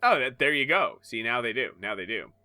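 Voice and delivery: ordinary talk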